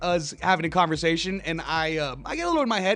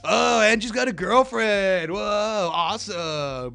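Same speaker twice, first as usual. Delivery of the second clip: drawn-out, teasing fake envy; mock jealous; slower than usual. Guest ribbing host for bringing up his lady, mock jealous. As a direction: needle him with stretched-out mock awe.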